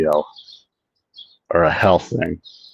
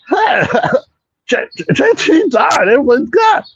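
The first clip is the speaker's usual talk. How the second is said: Carl impression. Streamer imitating Carl announcing a death, high and shouted. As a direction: squeak it out like a cheery Carl. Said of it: "That's my Carl impression".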